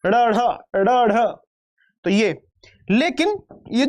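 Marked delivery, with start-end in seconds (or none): 0.1-1.3 teacher modelling ड़ and ढ aloud; sound demonstration. Shown as a demonstration. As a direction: sound out ड़, ढ clearly for students. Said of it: "उक्षिप्त का मतलब है ऊपर उछालना".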